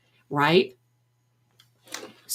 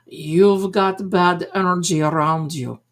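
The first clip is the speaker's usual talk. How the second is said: put-on measured Madame Blavatsky accent, on an even tune; mock psychic accent. Psychic naming her price, deliberate fake accent. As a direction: intone it in a fake mystic's accent, drawing out the price. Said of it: "I have that Madame Blavatsky accent".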